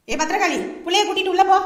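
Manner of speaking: sharp shout ordering her inside. Acted. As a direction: yell at the wife to take the child in. Shouting at his wife. (மனைவியிடம் கோபமாக கத்துதல்)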